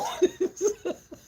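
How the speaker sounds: high amused cackle; high cackling laugh. Real, not acted. Laughing at own story.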